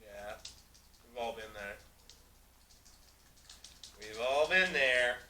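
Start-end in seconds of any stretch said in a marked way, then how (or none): 4.0-5.2 drawn-out louder mock-solemn yiddish accent; slow, louder knowing repeat. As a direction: stretch it out, louder, world-weary. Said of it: "repeated drawn out".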